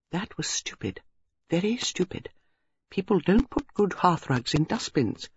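softly spoken, mild exasperation; vexed. A narrator's reading. Miss Marple scolds Basil about the hearthrug, vexed. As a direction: chide him quietly. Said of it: "clucked her tongue vexedly".

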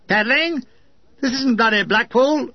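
a touch of outraged disbelief; indignant echo. Acted. Officer scolds the paddling gunner with mild outraged disbelief. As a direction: repeat the word as if it were absurd.

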